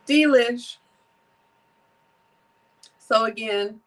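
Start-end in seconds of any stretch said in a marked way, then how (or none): 0.1-0.8 delighted. Real, not acted. Savoring the juice.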